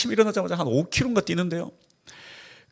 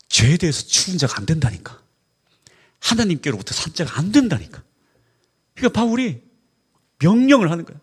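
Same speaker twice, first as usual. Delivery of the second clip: ordinary talk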